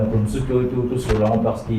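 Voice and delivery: ordinary talk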